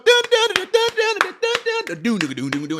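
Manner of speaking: sung high dun dun beat imitating a church organ; mock organ sting; mimicked church music. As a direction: hum the church band riff in a high voice. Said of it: "they got the music going".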